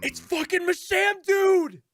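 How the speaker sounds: high, slow yell of disbelief; shocked yell. Real, not acted. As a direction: shout it in stunned disbelief. Shocked at surprise witness.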